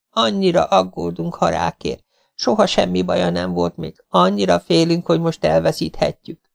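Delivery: high, worried and quavering; trembling voice. A narrator's reading. The wife fears losing her dog, trembling voice. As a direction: hold back tears as you speak. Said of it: "mondta remegő hangon".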